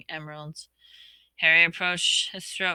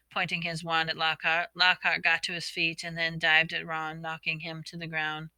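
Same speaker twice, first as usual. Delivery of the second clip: plain reading voice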